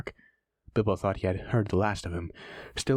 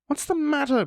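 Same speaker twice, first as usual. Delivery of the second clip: calling out. Acted. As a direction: call across the dark. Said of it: "Bilbo called".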